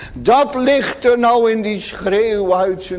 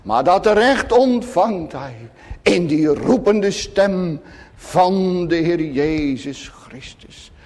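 ordinary talk

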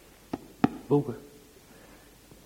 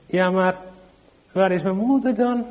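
put-on anxious little child; 7 semitones higher, 6 dB louder than usual. Child keeps asking for mother.

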